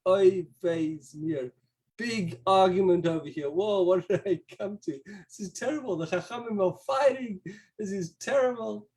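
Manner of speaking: put-on alarmed child. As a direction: gasp it like a worried kid. Young boy's alarmed inner thoughts.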